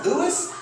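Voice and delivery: "Lewis" as LOO-iss with a high questioning rise; mispronounced name. Retold or imitated. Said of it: "that's what they call me".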